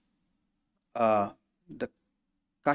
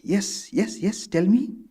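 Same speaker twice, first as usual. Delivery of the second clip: eager retelling of his 'yes, yes, tell me', pitched higher; eager interest; 8 semitones higher, 3 dB louder than usual. Retold or imitated. M retells how the master begged to hear his words: eagerly interested. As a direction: play the teacher hungry to hear his own words back. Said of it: "very interested".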